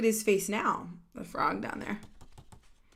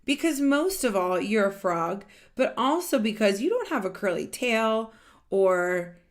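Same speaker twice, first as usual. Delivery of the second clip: louder, lively read-aloud of a character line; animated storybook voice; 7 dB louder than usual. Acted. Reading a picture book to children, animated and louder. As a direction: voice the characters brightly for the kids.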